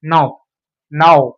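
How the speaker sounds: नाउ starting on n, with silent k; correct pronunciation. Shown as a demonstration. Teacher modelling the word for pupils, k left silent. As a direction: say नाउ slowly, no k sound. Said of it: "इसको pronounce करें नाउ".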